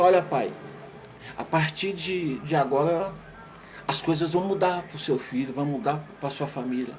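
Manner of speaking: grave doctor. Acted. Doctor breaking bad news to the father, low and hushed.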